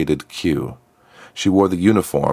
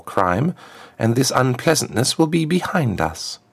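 high, smooth voice of a formal diplomat; a smooth ambassador, pitched well up; smooth contralto ambassador; 8 semitones higher than usual. A narrator's reading. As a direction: address royalty smoothly in a high-set voice. Plays the ambassador.

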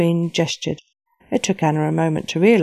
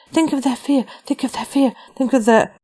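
strained urging; 7 semitones higher than usual. Acted. Anna urging herself, straining.

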